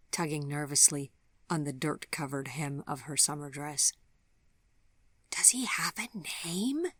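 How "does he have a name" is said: high, unvoiced little-girl whisper; stage-whisper like a small child; child's eager whisper; loud child whisper. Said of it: "she whispered loudly in her small child's way".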